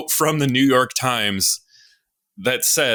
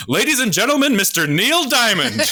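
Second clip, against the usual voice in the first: big showman intro, higher; mock announcer; 9 semitones higher than usual. Podcaster mimicking the MC introducing [name], hammed up.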